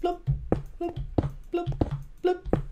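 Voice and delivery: a run of bloop noises, the last one high; playful bloop sounds; bloop sound effects. Pretend. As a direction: make silly bloop noises. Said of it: "Do you like my sound effects?".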